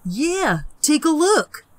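fake friendly. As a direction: lure her closer. Peter luring.

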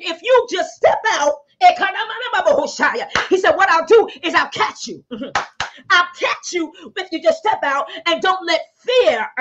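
fervent, rising preacher's cadence; preacher urging listeners out of fear; preaching. Real, not acted. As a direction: drive the word home to the flock. Preaching to congregation.

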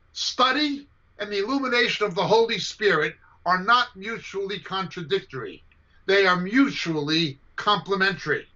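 usual speaking voice

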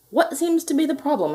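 high-pitched doctor's question; inquiring doctor, pitched right up; doctor character. A narrator's reading. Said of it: "asked the doctor".

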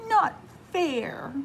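high whiny sulky protest; sulky child. Acted. Preston sent to his room, sulky whine. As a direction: sulk like a punished kid.

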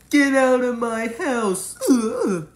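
high, loud, crying yell; tearful yell. Acted. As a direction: shout it through tears, slowly. Chasing clown out, crying.

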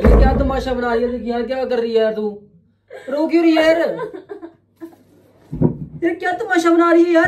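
high, loud scolding; rising, exasperated protest. Real, not acted. Husband scolding crying wife for making a scene, exasperated. Quarrel over her crying.

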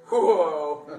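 loud excited shout of "Whoa!". Real, not acted. Preacher carried away, a loud whoa. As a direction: cry out in excitement.

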